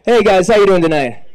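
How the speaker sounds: loud, high stage greeting; pumped-up crowd greeting; calling out to crowd. Real, not acted. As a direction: shout hello to the whole room.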